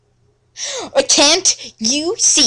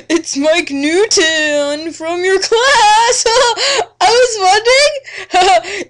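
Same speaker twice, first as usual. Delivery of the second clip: high, giggling and half-sobbing through the invite; a lovestruck boy who can't stop laughing; hammed-up, breaking into giggles; giggly nervous boy; 4 semitones higher than usual. Mike's nervous phone invitation.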